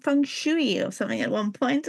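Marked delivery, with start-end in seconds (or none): 0.0-0.8 "feng shui" as "fung shoo-ee", pitched higher; old mispronunciation. Retold or imitated. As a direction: end "feng shui" on "shoo-ee", not "shway". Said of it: "I remember people calling it".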